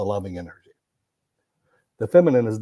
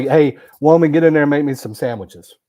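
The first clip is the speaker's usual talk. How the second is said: louder, higher mock demand to a wife; mock bossy husband; 4 semitones higher, 7 dB louder than usual. Mocking a man ordering his woman around, hammed-up. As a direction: bark it like a lazy husband.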